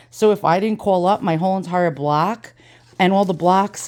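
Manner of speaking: ordinary talk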